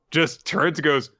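usual speaking voice

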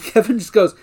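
usual speaking voice

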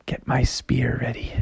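soft, secretive whisper; hushed hunter's self-talk; whispering to himself. Acted. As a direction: whisper it to yourself, stalking prey. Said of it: "he said to himself".

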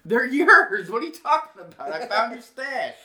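loud, high, fast protest; shouting back. Retold or imitated. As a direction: yell it back in defiance.

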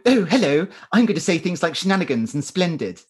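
hammed-up posh English accent, high and loud; mock posh British. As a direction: overdo a prim British accent. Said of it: "Be really British".

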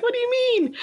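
very high, wounded squeal of protest; mock crushed. Wife retelling her reply to husband's verdict, comically crushed. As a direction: play up being crushed, pitch shooting way up. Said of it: "I was crushed".

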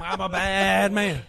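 mock wrestling villain, slow and loud. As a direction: ham it up like a cartoon heel. Entrance as Doctor Bad.

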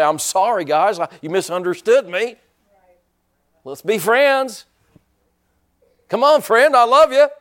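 building into a high, loud, pleading mock apology; mock apologetic pleading. Preacher mocking a people-pleasing Jesus, pleading mockery. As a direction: whine up to a big fake I love you. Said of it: "But he didn't say".

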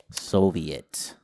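ordinary talk

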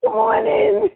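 high, giggly welcome through a laugh; laughing invitation. Retold or imitated. [name] invites her, laughing.

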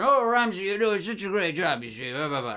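hammed-up British chap, trailing into blahs; British actor. Friend imitating the film's British guy, mock British accent. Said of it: "like, British actor".

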